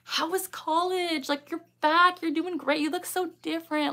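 high, gushing mock catch-up; joking welcome. Joking with Will to a returning tic, mock-gushing. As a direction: coo as if to a long-lost friend. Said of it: "Will and I always joke".

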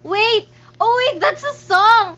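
loud, high exclaim; sudden realization. Real, not acted. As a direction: catch yourself in delighted surprise. Realizes chat is song lyric.

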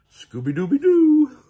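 very high, loud dog howl on the last word; Scooby's catchphrase. A narrator's reading. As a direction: howl it out in triumph. Scooby's closing signature howl.

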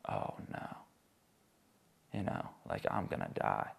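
whispered, terrified thought; voiceless, scared inner voice; frightened whisper. Retold or imitated. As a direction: breathe it out in a whispered panic. Inner panic, thinking of hell.

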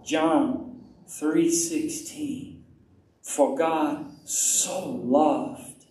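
very slow, stretched-out scripture verse; unhurried prayerful repetition; slow meditative recitation. Shown as a demonstration. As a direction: savour each word, pausing between them. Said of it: "And then I'll add to it".

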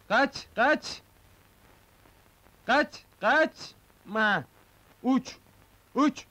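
short high-pitched urging calls; high, insistent shooing call. Acted. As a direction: urge it away in high, sudden cries. Urging an animal to fly off.

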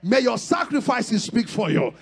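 loud, rhythmic blessing declarations with a sung lilt; fervent preaching prayer. Acted. Pastor declaring blessings over the congregation, loud fervent preaching. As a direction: pray over them like a revival preacher at full force.

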